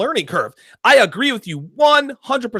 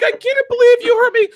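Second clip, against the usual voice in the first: high, louder whiny mock sob; mock crying girlfriend; 5 dB louder than usual. Ex calls him up in tears, mock high crying. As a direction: ham up a crying ex, pitched way up. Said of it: "calls me up crying".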